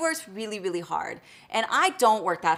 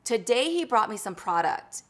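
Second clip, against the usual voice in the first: normal talking voice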